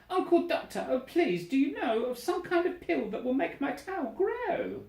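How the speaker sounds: very high, begging bird voice; pleading little bird. Acted. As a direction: wheedle the uncle for a pill. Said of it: "And she cried".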